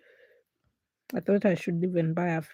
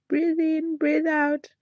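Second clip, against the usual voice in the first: higher, louder mock coach breathing; imitating papa guiding; 7 semitones higher, 3 dB louder, slower than usual. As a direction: chant the breaths like her dad. Dad guiding her breathing.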